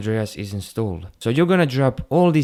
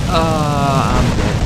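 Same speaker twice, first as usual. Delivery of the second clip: louder, drawn-out "ah"; caught-out yelp; 5 dB louder, much slower than usual. Real, not acted. Gamer killed mid-demonstration, caught-out yelp. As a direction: cry out as you get killed in game.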